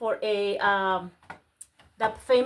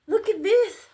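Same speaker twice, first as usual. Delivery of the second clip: high, loud excited cry; high-pitched burst of excitement; thrilled; 9 semitones higher, 5 dB louder than usual. Real, not acted. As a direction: exclaim it in real delight. Thrilled at finding an item.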